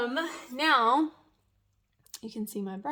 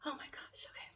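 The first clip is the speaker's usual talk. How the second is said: much softer whisper of relief; relieved whisper; 15 dB quieter, faster than usual. Real, not acted. Just after the rip, relieved whisper. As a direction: let it out breathless.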